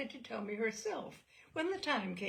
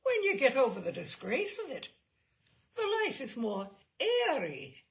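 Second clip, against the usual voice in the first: put-on lilting Irish accent, louder and higher; an old Irish woman's voice, raised; 4 semitones higher, 6 dB louder than usual. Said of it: "Irish voice".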